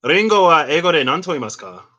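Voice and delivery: careful Japanese; Japanese question. Shown as a demonstration. Asking Siri the English for ringo, careful Japanese. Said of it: "Let's say".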